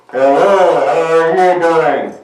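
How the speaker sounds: buzzy kazoo-filtered voice; talking through a kazoo. Shown as a demonstration. Showing kids how a kazoo changes your voice, buzzy kazoo talk. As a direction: speak right into the kazoo so it buzzes. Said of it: "You can just talk like this".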